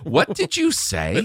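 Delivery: high, slow mock-baffled question; playing stunned disbelief at a rude joke; baffled what. Stunned parents at pickup asking him to repeat, high and slow.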